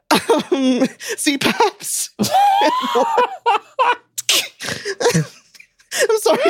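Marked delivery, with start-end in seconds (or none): 2.1-3.9 high shrieking laugh. Real, not acted. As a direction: burst into a screaming laugh. Laughing shout.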